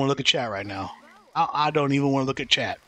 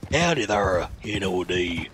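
put-on cowboy accent; 8 semitones lower, slower than usual. Reading chat as cowboy.

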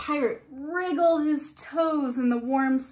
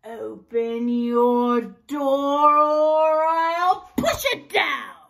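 slow, loud pirate threat; lightly voiced pirate leaning on the door; wicked pirate; 7 dB louder, much slower than usual. Wicked pirate demanding entry, lightly growled. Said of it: "said the wicked pirate".

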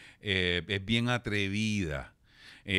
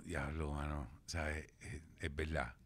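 sheepish self-quote; 8 semitones lower, 14 dB quieter than usual. Retold or imitated. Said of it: "Y yo".

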